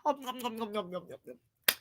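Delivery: soft, repeated munching hums; mock om-nom eating sounds; gobbling noises. Imitates gobbling the shrimp.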